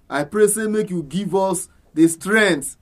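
usual speaking voice

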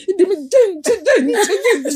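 put-on high, giggling toddler voice; child's lisping words. Mother repeating her child's babble, high and laughing. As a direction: lisp it like a three-year-old.

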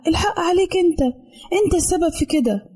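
put-on high, tearful angry comeback from a little girl; angry tearful retort. A narrator's reading. Sister blaming her brother over the broken doll, angry and tearful. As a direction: snap back through tears, pitched high. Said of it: "وراحت ردت أخته بغضب".

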